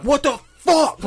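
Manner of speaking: high, drawn-out outraged shout; retold outburst of road anger; outraged yell. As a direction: yell it slowly in high-pitched disbelief. Retold furious reaction to collision.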